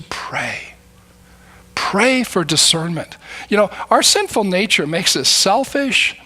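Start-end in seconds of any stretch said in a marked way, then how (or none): 0.0-0.7 whispered. Real, not acted. Whispered key word.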